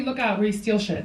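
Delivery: usual speaking voice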